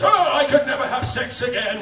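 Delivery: put-on high, loud old man's wail; old guy's lament. The voice actor showing his Hellsing character, loud high old man's wail. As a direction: wail it like a broken old man. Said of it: "I'm the old guy who says".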